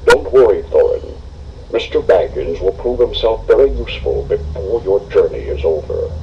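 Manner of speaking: put-on calm the dwarf like a wise old man; old wizard. Gandalf reassures Thorin.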